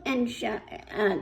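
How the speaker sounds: usual speaking voice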